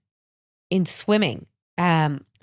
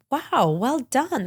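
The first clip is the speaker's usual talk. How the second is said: higher, slow and admiring; impressed praise; 6 semitones higher, much slower than usual. Retold or imitated. Husband congratulating his wife on the podcast award, impressed and slow. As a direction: savor the praise, well done drawn out.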